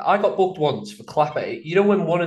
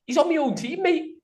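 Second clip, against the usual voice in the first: protesting to the ref; 13 semitones higher, 3 dB quieter than usual. Retold or imitated. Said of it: "I was like".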